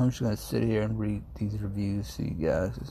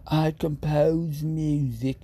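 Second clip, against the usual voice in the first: slow, higher English accent, each word savoured; England voice; 4 semitones higher, 4 dB louder, much slower than usual. Shown as a demonstration. Streamer demonstrating the English voice to chat. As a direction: say it like a grand English composer. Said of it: "You have to have the England voice".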